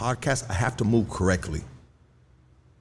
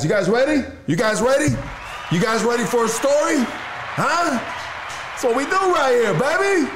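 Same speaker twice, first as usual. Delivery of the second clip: high, loud crowd hype; hype shout; 5 dB louder than usual. Real, not acted. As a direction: pump up the crowd. Hyping the audience.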